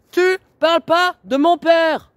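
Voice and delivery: high, loud and drawn out, slow; full scream. Real, not acted. Daughter screams at them not to mention her father, raging. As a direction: stretch every word into a scream.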